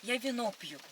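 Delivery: ordinary delivery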